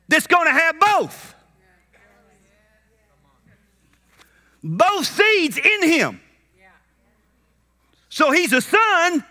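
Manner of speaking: high, emphatic preacher delivery; fervent preaching. Real, not acted. Preacher telling church about the seed, fervent preaching.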